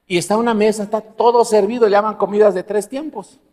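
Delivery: usual speaking voice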